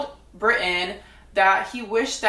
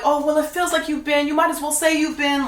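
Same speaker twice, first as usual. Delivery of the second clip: higher sing-song mock of the group; mocking imitation; 5 semitones higher than usual. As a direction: parody the others' fake reassurance. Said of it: "everybody would be like".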